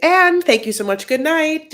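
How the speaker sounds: loud, high fake goodbye to end the show; hammed-up loud good night; mock sign-off. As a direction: pretend to wrap up the whole episode. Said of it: "Just kidding".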